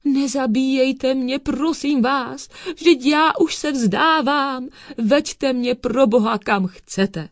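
high, wailing plea; begging. A narrator's reading. Thief on his knees before cat and dog, pleading. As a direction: beg for mercy in drawn-out cries. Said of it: "zvedl ruce k nebi a prosil".

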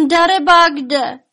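high, loud warning cry; calling out in alarm. A narrator's reading. Elves warning of the coming dragon, alarmed shout.

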